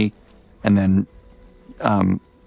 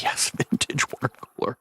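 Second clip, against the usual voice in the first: laughing whisper; 4 semitones higher, 7 dB quieter than usual. Retold or imitated. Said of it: "I'm like".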